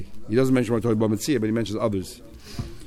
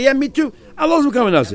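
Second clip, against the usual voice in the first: 1 eager me too; 10 semitones higher, faster than usual. Retold or imitated. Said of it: "So I'm continuing".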